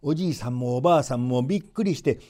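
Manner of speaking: ordinary delivery, nothing marked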